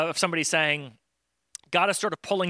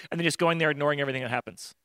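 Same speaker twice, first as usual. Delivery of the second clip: ordinary talk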